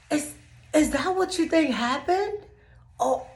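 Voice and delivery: high, airy mock disbelief; dramatic disbelief. To the confused coworker, hammed-up high disbelief. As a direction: overplay innocent bewilderment. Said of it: "I'm so dramatic".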